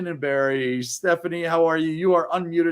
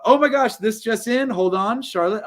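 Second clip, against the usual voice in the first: sudden excitement; 6 semitones higher than usual. Real, not acted. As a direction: interrupt with thrilling news. Breaking news announced excitedly.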